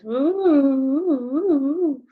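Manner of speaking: wobbly playful ooh sounds; playful ooing for children; funny voice noise. Shown as a demonstration. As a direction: make a silly ooh like a toy. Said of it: "It's making a funny noise".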